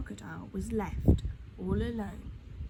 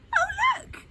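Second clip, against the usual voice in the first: very high, loud thrilled exclamation; children shout in delight at the seesaw; delighted cry; 9 dB louder than usual. A narrator's reading. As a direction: squeal it like children spotting a treat. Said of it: "they cried".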